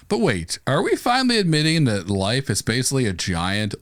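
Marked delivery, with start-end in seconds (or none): none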